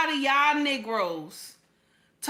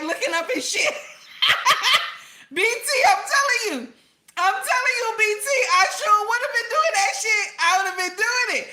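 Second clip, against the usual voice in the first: ordinary talk, nothing marked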